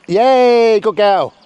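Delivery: high, loud cheering praise; excited praise. Real, not acted. Trainer cheering the sheepdog after a first success. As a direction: cheer her on as she gets it. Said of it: "bit of encouragement".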